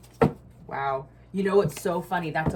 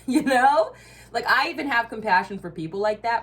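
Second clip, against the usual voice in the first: louder, higher heated rant; getting angry; 7 semitones higher, 7 dB louder than usual. Real, not acted. Ranting at energy suckers, loud heated. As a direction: let the anger rise with a laugh. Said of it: "see how I get angry?".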